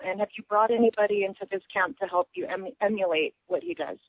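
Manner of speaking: ordinary delivery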